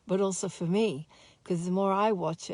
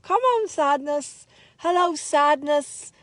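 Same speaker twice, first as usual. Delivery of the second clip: hammed-up greeting to sadness; welcoming sadness; 7 semitones higher, 9 dB louder than usual. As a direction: call sadness in like an old friend, much louder and higher.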